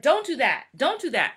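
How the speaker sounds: firm no to a misbehaving dog; scolding the dog. Retold or imitated. Scolding her dog, stern and loud. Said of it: "I can be like".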